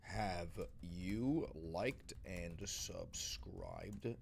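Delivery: drawn-out low voice, slowed way down; slow deep aside. Pretend. Asking viewers to subscribe, slow and deep.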